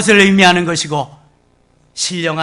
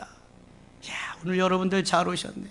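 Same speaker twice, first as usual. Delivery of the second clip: hushed, quick aside to the room; a quiet delighted aside to the congregation; whispered aside; 10 dB quieter than usual. Real, not acted. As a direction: lean in and murmur it fast.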